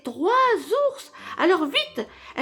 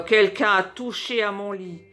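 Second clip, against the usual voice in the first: low, heavy bear growl; growling big bear; 4 semitones lower than usual. A narrator's reading. Papa bear at his bed, growling. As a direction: growl it from the chest. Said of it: "grogne de sa grosse voix".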